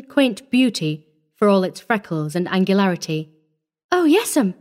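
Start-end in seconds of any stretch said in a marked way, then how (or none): 3.9-4.5 put-on high-pitched little girl's voice; breathed child's reply. A narrator's reading. Orphan girl accepting the offer of a home, breathed. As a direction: answer high, as a wondering child. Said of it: "she breathed".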